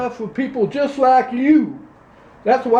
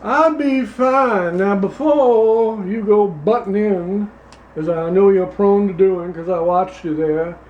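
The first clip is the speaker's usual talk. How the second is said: put-on Louisiana accent; 4 semitones lower than usual. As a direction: play a pompous Louisiana candidate, Southern accent. Same character continues.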